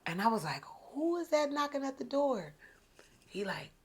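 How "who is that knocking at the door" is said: high, mildly mock puzzled question; retold door query. Woman answers an unexpected knock, high retold query. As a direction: act out asking who is knocking, pitched up.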